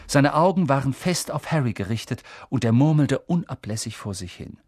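plain reading voice

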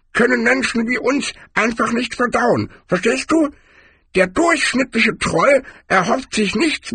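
very high-pitched barbarian character voice; high character voice, far from the narrator's; Cohen. A narrator's reading. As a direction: squeak it out high, explaining troll habits.

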